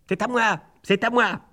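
put-on high, childish claiming cry; rival blackbird. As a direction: claim the tree like a squabbling bird.

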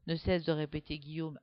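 usual speaking voice

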